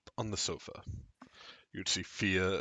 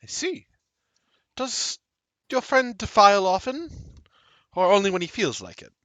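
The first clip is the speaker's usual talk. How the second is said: high, emphatic character voice; high-voiced, pointed questioning; curious mysterious woman; 10 semitones higher, 10 dB louder than usual. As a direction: probe with a raised pitch and volume.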